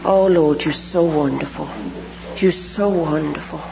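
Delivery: low, reverent murmur to God; hushed prayer. Real, not acted.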